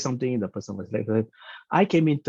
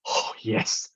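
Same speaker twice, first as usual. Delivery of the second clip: whispered aside; 4 dB quieter than usual. Retold or imitated. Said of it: "My eyes went".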